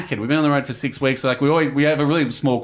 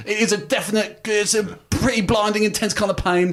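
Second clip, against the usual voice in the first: imitating the Venom Hunter; 8 semitones higher than usual. Telling cohost about the ex-soldier, hammed-up tough-guy impression. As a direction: ham up a stoic commando gritting through pain. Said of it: "grits through it and goes".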